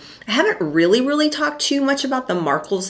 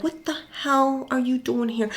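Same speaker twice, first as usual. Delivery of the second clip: higher, softer exasperated comedian; Sebastian Maniscalco impression; 7 semitones higher, 7 dB quieter than usual. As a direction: hiss it at someone at the door. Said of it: "that skit where he's opening the door and he's like".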